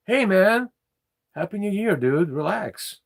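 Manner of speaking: loud, high-pitched shout to a passerby; calling out. Real, not acted. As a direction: call out high and loud, telling them to relax. Calling out to someone during the recording.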